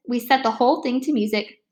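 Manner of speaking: ordinary delivery, nothing marked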